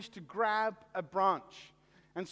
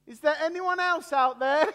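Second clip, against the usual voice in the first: higher, louder punchline call; comic shouted question; 5 semitones higher, 6 dB louder than usual. Acted. Man on the cliff, doubting God, calling out louder. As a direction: call out hoping for someone else. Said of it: "and he said".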